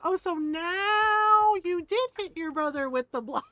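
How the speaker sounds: loud, stretched-out mock realization; sarcastic mom. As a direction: imitate an exasperated mother catching a fib. Retold mom's sarcastic reaction.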